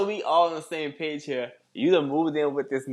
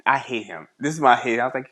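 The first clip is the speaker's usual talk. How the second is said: ordinary talk, nothing marked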